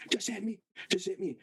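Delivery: high, repeated goading; retold screech, held back in volume; mock screaming dare. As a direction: imitate a sister egging you on. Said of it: "And she's screaming".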